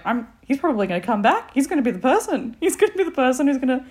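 mock panic; panicked thought. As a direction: let the dread build quickly. Panicked inner thought retold.

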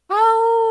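high, loud howling cry; wolf howl. Pretend. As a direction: let out a lone wolf howl. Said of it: "That was my lone wolf howl today".

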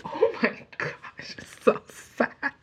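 high, slow whimpering "so sad"; mock weepy wail. Fan retelling crying at Lyra's speech, high weepy wail. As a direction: wail it like you're about to cry.

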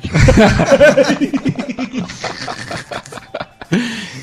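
long laugh. Real, not acted. Friends joke about old student.

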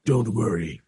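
low, quiet boss in the shadows; shadowy executive. Acted. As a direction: reassure the intern in a low, cool voice. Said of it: "balding executive sitting in a shadowed room".

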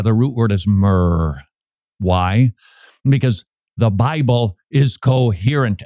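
usual speaking voice

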